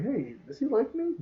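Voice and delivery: high, hopeful question; high wondering voice. Retold or imitated. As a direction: lift it up, hopeful and unsure. Character's hopeful thought.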